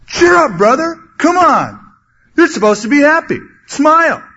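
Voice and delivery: loud, high, pushy cheer-up; slap-on-the-back forced cheerfulness, shouted; mock cheer-up shout. To a depressed brother, loud pushy cheer-up. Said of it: "That's like kicking someone when they're down".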